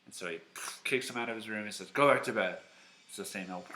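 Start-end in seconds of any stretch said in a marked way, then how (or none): 1.9-2.6 loud, mock-cross command; grumpy order. Eli kicking Samuel out of his room, loud and cross. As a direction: send him away forcefully. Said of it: "Eli wakes up again".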